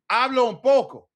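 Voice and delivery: loud, high hablo un poco; emphatic demo. Shown as a demonstration. Teacher showing how to sound tentative, loud and high. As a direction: stress poco with a hand gesture. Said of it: "that's why we use our hands".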